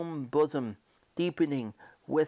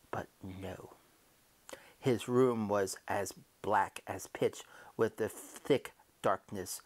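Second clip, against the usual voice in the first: ordinary delivery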